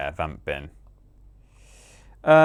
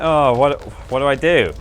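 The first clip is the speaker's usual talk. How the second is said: lightly acted fluster, raised and a bit louder; mock flustered player; 4 semitones higher, 4 dB louder than usual. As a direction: play at being stumped, in mild mock panic.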